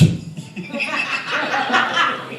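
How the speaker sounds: soft, high suppressed laughing; helpless wheezy laughter. Retold or imitated. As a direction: act out failing to hide amusement. Acts out laughing at the incident.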